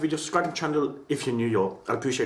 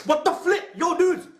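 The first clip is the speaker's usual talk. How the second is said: surprised; 11 semitones higher than usual. Real, not acted. Another sneeze startles him.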